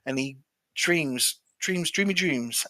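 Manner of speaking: slow, drawn-out words; dreamy storytelling. A narrator's reading. Telling how the man sleeps at the monastery, slow and dreamy. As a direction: linger dreamily on each word.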